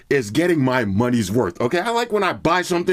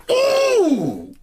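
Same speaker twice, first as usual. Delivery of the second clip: excited ooh. Real, not acted. Hyped by the bass.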